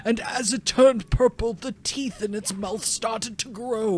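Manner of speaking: slow, eerie creepypasta narrator; spooky storyteller voice. Acted. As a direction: tell it like a ghost story by candlelight.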